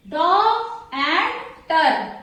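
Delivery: word broken into separate beats; syllable by syllable. Shown as a demonstration. Teacher showing two syllables to students, pieces set apart. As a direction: sound it out in pieces with pauses. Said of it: "pronounced this word in two syllables".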